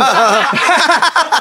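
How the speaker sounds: bright laugh after the joke; real laugh. Real, not acted. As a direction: burst out laughing at your own bit. Laughing at his own skit. (tawang tunay pagkatapos ng biro)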